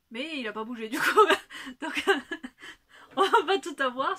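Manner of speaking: amused laughter breaking into the words; laughing. Real, not acted. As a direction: giggle at her own result. Laughing at unshrunk sweater.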